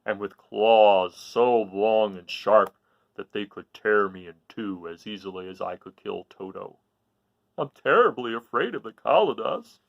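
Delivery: slow, low-set lion voice, a touch apart from the narrator; a big beast describing monsters, slowly. Acted. The lion tells Dorothy about the Kalidas, slow lion voice. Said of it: "replied the lion".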